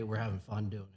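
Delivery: normal talking voice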